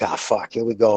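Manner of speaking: low, soft resigned mutter to himself; quiet inner groan. Retold or imitated. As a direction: grumble it under your breath.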